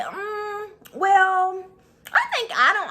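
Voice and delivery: put-on high, loud prissy voice of the girl; mock her fake-innocent tone; mimicking rival. Mocking the girl telling the coach, high prissy voice. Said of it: "She like".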